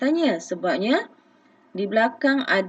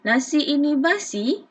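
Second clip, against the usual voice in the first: rising question tune, higher; sung-out question intonation; questioning rise; 6 semitones higher than usual. Shown as a demonstration. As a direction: lift the end like a real question. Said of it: "intonasi suara kita pun, okey, ada lenggok dia".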